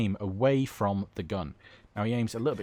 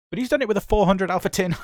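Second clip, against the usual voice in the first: high, loud, rushed disbelief; fast, raised-pitch astonishment; incredulous excitement; 9 semitones higher, 6 dB louder, faster than usual. Real, not acted. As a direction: blurt it out, amazed, on the edge of laughter. Amazed at the feat, laughing after.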